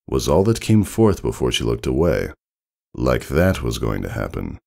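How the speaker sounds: ordinary delivery, nothing marked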